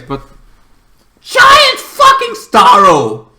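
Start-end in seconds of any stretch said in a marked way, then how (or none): 1.2-3.3 very high, loud and slow, stretched out; awed outburst. Real, not acted. Vlogger hyping the giant monster, loud awed shout. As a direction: belt it like you can't believe the size of it.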